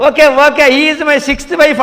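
high, loud mock reply; imitated brazen husband. Husband answering about his wife, mocking high voice. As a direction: call it out slowly, pitched well up.

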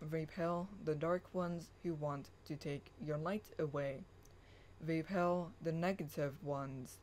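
hushed affirmation reading; incantatory reading. Acted. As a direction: read it like a quiet invocation. Affirmations read from blog.

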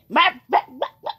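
short barking bahs; imitating a pet. Player imagining Frogger here, mock animal barks. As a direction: bark it in quick bursts. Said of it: "that's a dog".

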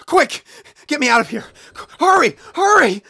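high frantic cries; panicked shout. Acted. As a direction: yell for help in panic. Fleeing the angry beast.

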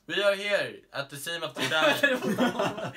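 slow, loud, giggling news line; laughing through it. Acted. Reporter opens the crime scene report, breaking into laughter. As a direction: try to stay in reporter mode while cracking up. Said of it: "laugh heard".

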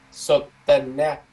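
Sotena said very slowly with a long n; slow model pronunciation. Shown as a demonstration. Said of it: "Thì nó đọc là Sotena".

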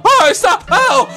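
ordinary talk, nothing marked

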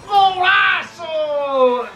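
stretched goal shout. Real, not acted.

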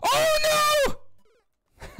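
loud cheer climbing into a high scream; celebrate, then scream in dismay; triumph turning to alarm. Real, not acted. Player got too excited and slipped, cheer turning to scream. Triumph turns into failure in game.

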